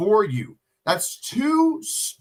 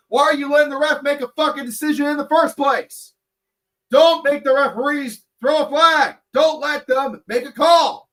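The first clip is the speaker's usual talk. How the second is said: raised, louder, pitched-up scolding; heated rant; 7 semitones higher, 5 dB louder than usual. Real, not acted. To players who let refs decide, a higher, louder heated scolding. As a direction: lay into them, voice climbing. Coach ranting at careless players.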